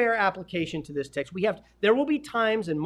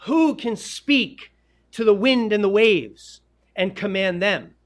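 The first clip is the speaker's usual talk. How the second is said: loud, slow, emphatic amazement; acted awe; 2 semitones higher, 7 dB louder, slower than usual. Disciples marveling at who commands wind and waves, acted awe. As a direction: play stunned followers, weighting each big word.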